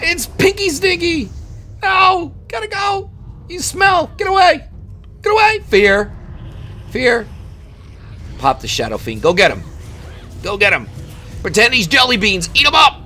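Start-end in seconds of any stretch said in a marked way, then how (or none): 0.0-7.3 much higher, squealing hammed-up fright; shrill cartoon panic at the rogue; squeaky mock panic. 9.2-13.0 cheering on pet. Real, not acted. Urging his pet on.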